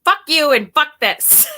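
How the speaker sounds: imitating her own fed-up outburst at the app; mock angry retort. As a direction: fling it at the meditation voice. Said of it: "And I'm like".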